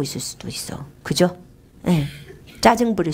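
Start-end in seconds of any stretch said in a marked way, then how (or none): 0.0-0.9 잠든 사람 깨울까 속삭이듯; 숨소리로만 낮게 속삭이기; whisper. Retold or imitated. Mentor asleep at midnight call. (한밤중 전화 받는 멘토 흉내, 속삭임)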